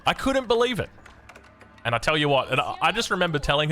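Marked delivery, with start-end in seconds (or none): 0.1-0.9 "couldn't" pitched much higher in disbelief. Real, not acted. Retelling mum's steak ban to viewers, higher-pitched disbelief. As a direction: repeat it, now outraged and incredulous.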